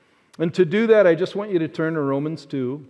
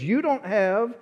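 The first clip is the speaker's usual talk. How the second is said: higher, rising disbelief; surprised question; 6 semitones higher, much slower than usual. Retold or imitated. Friend surprised by the narrator's sneakers, high and amazed. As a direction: sound amazed he has no golf shoes. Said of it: "He goes".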